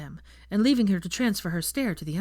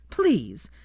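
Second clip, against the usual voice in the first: loud scornful; 11 semitones higher, 4 dB louder than usual. Acted. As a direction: scorn it loudly. Hermione storming.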